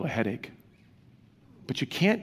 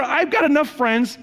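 mock complaint to wife; 12 semitones higher, 5 dB louder than usual.

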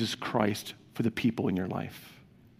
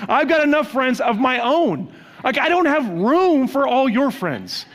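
mock complaint to wife; 10 semitones higher, 5 dB louder than usual.

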